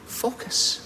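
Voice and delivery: a sudden breathy whisper of one word; whispered command to focus; hushed focus. Acted. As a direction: breathe it out to draw them in. Preacher urging listeners to focus.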